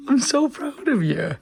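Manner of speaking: high, loud, drawn-out gushing; gushing praise. Pretend. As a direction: playfully gush with pride, slowly. Playful praise on finding Lydia editing.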